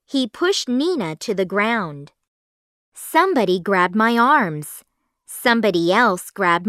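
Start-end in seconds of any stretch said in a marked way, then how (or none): none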